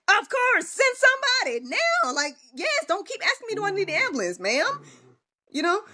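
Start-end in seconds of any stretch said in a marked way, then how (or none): 0.1-4.8 high, pushy, exasperated insisting; frantic demand. Retold or imitated. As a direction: tell the operator to stop asking, high and urgent. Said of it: "I'm like".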